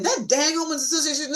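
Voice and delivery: quick high mocking gripe with a laugh; commiserating laugh at the HOA; laughing complaint. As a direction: join the gripe with a fast laugh. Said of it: "If I said to him".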